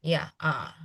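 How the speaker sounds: ah sunk low; ah as a low dipping tone; tone three. Shown as a demonstration. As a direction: model ah low and soft. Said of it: "Rendah dia".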